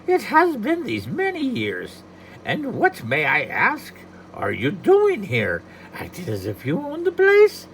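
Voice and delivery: high, slow little leprechaun voice; a tiny leprechaun standing on his dignity; indignant leprechaun. Acted. Leprechaun Patrick Begorra voiced.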